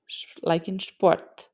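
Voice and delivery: Sport said with sh, slow "sh-" then "Sport". Shown as a demonstration. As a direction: draw out the sh before Sport. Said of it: "the pronunciation is Sp".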